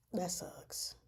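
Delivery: hushed whisper of sympathy; whispered dismay. Real, not acted. Reacting to the grim history, a whispered sympathy. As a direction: breathe it out under your breath.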